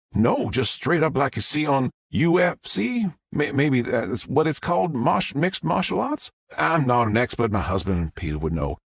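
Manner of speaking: a Southern mom gushing to the press; Southern witness. Acted. Southern witness tells story.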